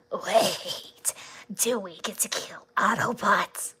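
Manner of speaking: loud raspy gleeful little menace; loud, raspy, unhinged villain voice; raspy crazy Beetlejuice voice. Shown as a demonstration. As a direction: rasp it out like an eager chaotic villain. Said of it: "Like, raspy and crazy".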